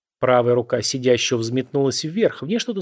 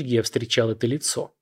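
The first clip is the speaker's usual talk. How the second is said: usual speaking voice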